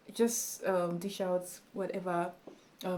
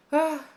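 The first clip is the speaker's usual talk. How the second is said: loud, high ah of exasperation; exclaimed ah; 8 semitones higher, 10 dB louder than usual. Real, not acted. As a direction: blurt out a high ah. Exclaims over word choice.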